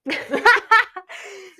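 high, loud burst of real laughter. Real, not acted. Co-host cracks up at the friend's story, laughing loudly.

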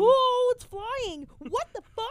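high, loud startled shriek; panicked alarm. Real, not acted. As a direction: cry out in panic at a flying bug. Panic at a flying bug.

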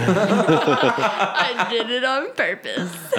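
loud laughing confession; laughing it out, slowly. Real, not acted.

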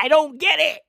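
high, exclaimed confusion; mock bafflement. After imitating the actress's accent, high-pitched bafflement.

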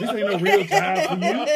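slow, laughing and loud. Retold or imitated. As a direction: laugh through the jab. Mocking line laughing.

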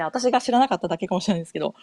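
usual speaking voice

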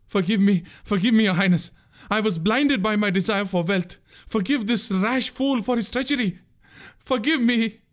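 high, anguished sobbing begging; sobbing plea. A narrator's reading. Boatman begging the prince's forgiveness, sobbing. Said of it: "he sobbed".

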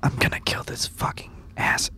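soft unvoiced whisper. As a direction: whisper it under your breath. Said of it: "I whisper to Nilo".

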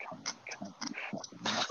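whispered, menacing summons; very soft, voiceless imitation; beckoning under breath. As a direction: whisper it as you summon someone over.

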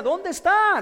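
high, loud searching shout; desperate shout. Retold or imitated. Blind man shouting to find Jesus, high loud shout. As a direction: yell out, lost in the crowd.

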